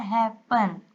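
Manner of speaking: "Happen" in two beats, हैप and पन; syllable division. Shown as a demonstration. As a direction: lightly separate "happen" at the double p. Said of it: "इसमें जो middle के दो consonants हैं". ("Happen" को दो हिस्सों में: हैप, पन)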